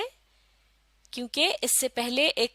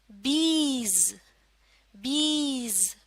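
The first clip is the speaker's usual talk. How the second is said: बीज stretched very slowly; slow reading demo; slow blending; much slower than usual. Shown as a demonstration. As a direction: pull बीज out long. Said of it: "क्या बनेगा?".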